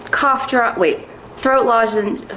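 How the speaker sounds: normal talking voice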